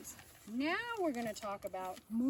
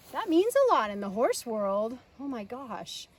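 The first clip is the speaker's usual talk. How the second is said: normal talking voice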